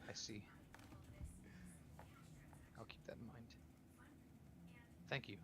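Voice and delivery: very soft, near-whispered reply; subdued, barely voiced thanks; hushed acceptance. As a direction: take it in quietly, almost whispering. Subdued character taking in answer.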